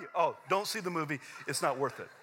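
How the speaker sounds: usual speaking voice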